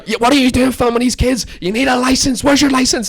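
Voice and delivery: loud, high mock screech of accusation; imitated screaming. Woman screams at teller about a license, mock screech. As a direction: mimic a woman screaming demands at you. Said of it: "she's screaming at me".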